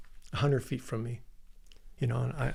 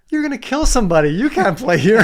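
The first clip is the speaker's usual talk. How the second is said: very loud, high hollered warning; imitated yelling; 9 semitones higher, 13 dB louder than usual. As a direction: yell it across the green, breaking into laughter at the end. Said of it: "he yells out".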